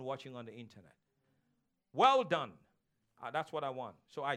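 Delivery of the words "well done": a loud shout to the congregation; loud proclamation. Real, not acted. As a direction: ring out much louder. Calling out the punchline.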